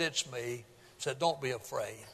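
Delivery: quiet calm Jesus voice; calm reassurance. Acted. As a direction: soothe frightened disciples. Jesus voiced calmly in story.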